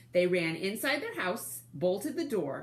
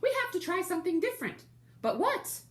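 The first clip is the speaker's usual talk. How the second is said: squeaky little wolves; 12 semitones higher, faster than usual. Acted. Little wolves refusing the pig through the door, very high and squeaky.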